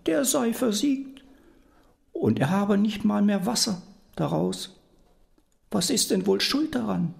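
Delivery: put-on high, sweet old woman telling a dream; grandmother voice. Grandmother pretends to tell the devil her dream, high and innocent. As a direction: innocently coax the answer out.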